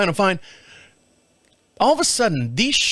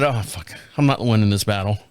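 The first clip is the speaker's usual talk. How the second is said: defeated grumble; 8 semitones lower than usual. Retold or imitated. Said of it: "I said".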